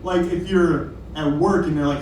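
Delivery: usual speaking voice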